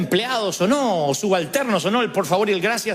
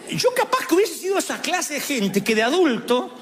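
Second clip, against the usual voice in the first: ordinary talk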